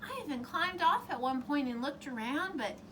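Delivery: high, loud, exasperated princess; complaining princess. Acted. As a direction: pour out the bad night. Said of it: "the princess said".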